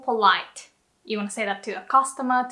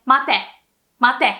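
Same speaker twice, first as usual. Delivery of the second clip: rude order; 5 dB louder than usual. Shown as a demonstration. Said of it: "How to sound rude".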